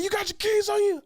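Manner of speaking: high, urgent mock yell; agitated questioning. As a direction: press a drunk friend, voice way up. Acts out agitated friend demanding keys.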